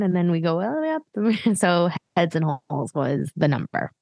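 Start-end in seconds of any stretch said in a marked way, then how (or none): 0.3-1.6 voice rising with the move. Shown as a demonstration. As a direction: pitch climbs on all the way up. Describing dance move going up.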